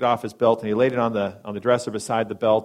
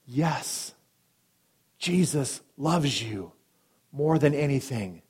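tender reassurance; 3 semitones higher, much slower than usual. Retold or imitated. Pastor comforts dying man.

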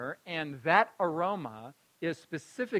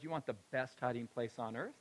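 casual grandma; 6 semitones lower, 13 dB quieter than usual. Retold or imitated. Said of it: "rather casually says".

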